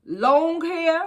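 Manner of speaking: ordinary talk, nothing marked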